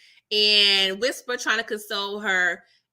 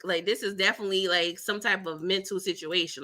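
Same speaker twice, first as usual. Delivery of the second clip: ordinary delivery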